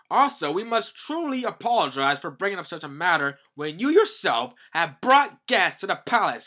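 high, urgent official's address; hammed-up palace minister; loud formal proclamation. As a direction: declaim to the king with fuss. Voicing ministers urgently addressing king.

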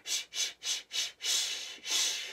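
short puffs of "shh", then longer; breathing pushes. Shown as a demonstration. Teacher leading tummy breathing, rhythmic shh. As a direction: push out rhythmic shushes from the tummy. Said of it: "Let's do some breathing".

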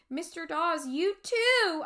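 crying out. A narrator's reading. Amanda to Mister Dawes at the graves, crying out. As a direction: cry it out in dismay. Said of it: "I cried".

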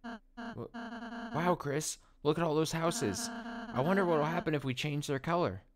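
high, soft voice of wonder; wonderstruck friend. Acted. Pointing out houses to Chris, soft wonderstruck friend.

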